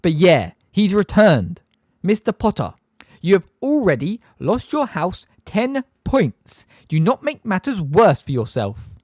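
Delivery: high, slow, prim schoolmistress; Umbridge. A narrator's reading. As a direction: spell it out with sugary menace. Umbridge scolding Harry.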